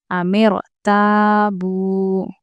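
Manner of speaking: very slow chanted अमिर ताबू; Quranic recitation. Shown as a demonstration. Teacher reciting a drill word for students, very slow. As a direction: intone अमिर ताबू slowly, recitation style. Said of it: "देखिए".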